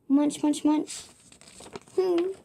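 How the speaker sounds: ordinary delivery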